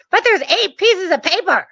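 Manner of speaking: high-pitched mock protest; mad bank rep. Bank rep objecting to the near-empty box, mock angry. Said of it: "she was so mad".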